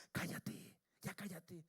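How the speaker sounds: hushed, breath-heavy telling-off; crowd hushing someone. Retold or imitated. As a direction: imitate a crowd shushing a beggar under its breath. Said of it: "la gente le decía".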